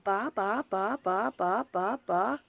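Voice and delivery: light repeated ba syllables, like her daughter; gentle imitation of a nonverbal child's babbling; imitating Chloe's babble. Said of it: "'cause that's how she talks".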